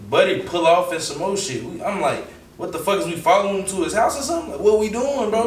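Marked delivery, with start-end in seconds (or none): 2.6-5.5 high, fast, exasperated questions; incredulous high-pitched protest; impatient questioning. Retold or imitated. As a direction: push them, pitched way up. Impatient quote to friends.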